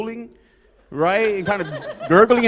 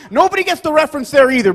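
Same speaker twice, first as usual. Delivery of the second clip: ordinary talk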